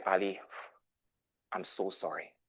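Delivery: soft, low sincere sorry; tender apology. Retold or imitated. Tutor apologizing to the grieving boy, softly tender. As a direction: comfort him gently.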